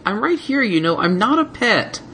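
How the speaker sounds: put-on baby dragon voice, high and offended; indignant baby dragon. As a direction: insist you're not a pet. Spike indignant protest.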